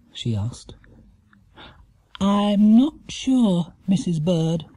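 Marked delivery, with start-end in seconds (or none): 2.2-4.7 high, slow uncertain bear voice; hesitant. A narrator's reading. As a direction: draw it out, unsure of yourself. Bear unsure as pain begins.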